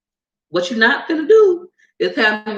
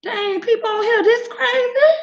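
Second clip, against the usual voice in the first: high, whining shocked voice; hammed-up stunned reaction; mock disbelief. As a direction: gasp at how crazy people are. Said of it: "I'm like".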